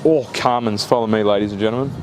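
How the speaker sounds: normal talking voice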